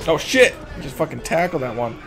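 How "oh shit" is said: loud, higher burst of alarm; real startled outburst; startled shout. Real, not acted. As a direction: yell out in sudden shock. Startled by attack in game.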